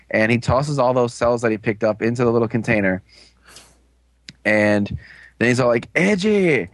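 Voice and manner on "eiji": acted order. Acted order to Eiji in recap.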